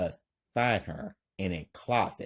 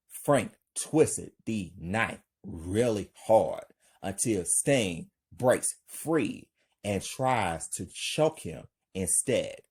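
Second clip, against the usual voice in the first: usual speaking voice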